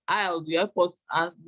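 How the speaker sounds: ordinary talk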